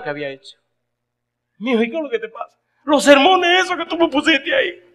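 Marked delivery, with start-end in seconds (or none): 1.6-2.6 worried mother. Retold or imitated. Mother asking again. 2.8-4.8 high, loud tearful reply; sobbing answer. Retold or imitated. Son crying on phone.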